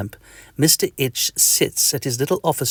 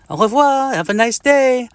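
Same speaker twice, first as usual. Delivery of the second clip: put-on a mosquito with a comic French accent, higher; 13 semitones higher, 4 dB louder than usual. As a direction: greet him in a cartoon French accent.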